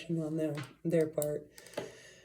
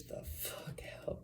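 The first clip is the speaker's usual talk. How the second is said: whispered; 18 dB quieter than usual. Real, not acted. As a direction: let it drop to a breathless whisper for the scary part. Stepdad seeing the demon.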